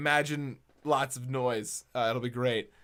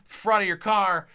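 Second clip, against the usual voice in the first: higher, louder, fast innocent babble; frantic kid lie; 4 semitones higher, 6 dB louder than usual. Retold or imitated. Boy lying to dad about the headlight, higher and fast. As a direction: talk fast like a guilty kid covering up. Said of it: "I hit my dad up with".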